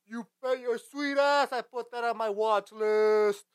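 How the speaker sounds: high, slow, stretched-out declaration; hammed-up enthusiasm. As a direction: proclaim it with exaggerated relish. Exaggerated boast about a silly movie.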